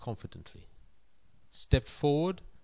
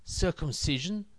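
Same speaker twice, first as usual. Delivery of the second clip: slow, knowing punchline, dragged out; 8 semitones higher than usual. Acted.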